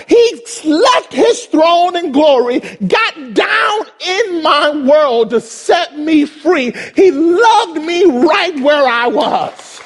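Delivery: fervent preaching. Real, not acted. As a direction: declare it with full emotion. Preaching climax, emotional.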